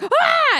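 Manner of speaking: short, high, loud shriek; imitated scream of sudden fright; mock startled scream. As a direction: yelp as if grabbed by a monster. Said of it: "she sees him and she's like".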